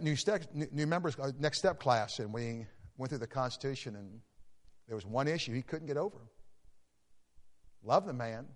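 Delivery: ordinary talk, nothing marked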